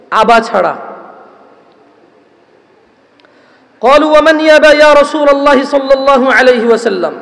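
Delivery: loud, measured sermon recitation with chanted Arabic; solemn preacher reciting scripture to the crowd; preaching a hadith. Acted. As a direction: intone the Arabic slowly, then preach the meaning.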